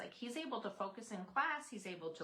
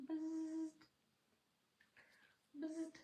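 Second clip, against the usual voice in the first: bug zapper zap; 9 semitones higher, 3 dB quieter than usual. Pretend. Showing the amber bee necklace.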